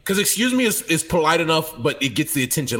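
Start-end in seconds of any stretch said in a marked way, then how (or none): 0.2-0.6 stern. Shown as a demonstration. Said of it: "they hear the sternness in your voice".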